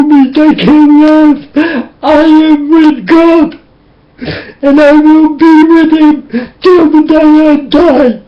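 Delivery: tearful vow, pitched high; crying out a lifelong vow; weeping. Real, not acted. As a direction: let the tears break through the promise. Emotional confession, weeping.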